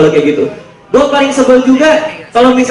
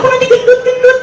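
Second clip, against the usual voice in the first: high mock-girlfriend voice, much higher than his own; girly falsetto. Hungry girlfriend answering her boyfriend, in high mock falsetto. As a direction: squeak it like a whiny girlfriend.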